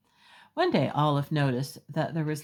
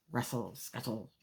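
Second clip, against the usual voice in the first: soft sound effect; 3 semitones lower, 10 dB quieter than usual. Acted. Sound words of lobster scuttling.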